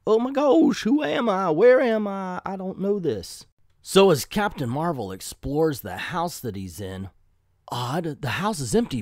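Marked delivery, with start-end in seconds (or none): 0.1-3.4 higher-pitched, mock-panicked confusion; dazed comic-book hero, pitched up; bewildered robot waking up. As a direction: act out waking up totally lost. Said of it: "it's him waking up holding his head".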